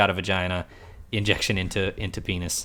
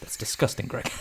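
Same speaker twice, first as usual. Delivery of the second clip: soft, whispered disgust; disgusted Jack; 8 dB quieter than usual. Retold or imitated. Jack in the car scolding Greg, whispered disgust. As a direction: breathe it out in quiet contempt. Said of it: "And Jack goes".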